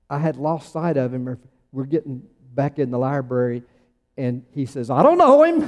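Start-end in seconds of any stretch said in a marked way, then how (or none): 4.9-5.6 high, loud comic denial; mock disowning. Host jokingly disowning his guest in the library, loud mock denial. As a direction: blurt the denial high and loud, half laughing.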